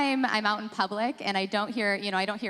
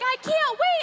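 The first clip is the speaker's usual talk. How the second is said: put-on much higher, gushing little-kid voice; excited kid; 5 dB louder than usual. Kindergartner telling her teacher about the baby, gushing and high. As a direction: gush it like a thrilled child. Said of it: "She's like".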